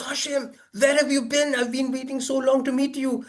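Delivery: high excited greeting; Stephen Hawking greeting. Retold or imitated. Old friend spotting him in traffic, high and eager. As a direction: call out with eager surprise.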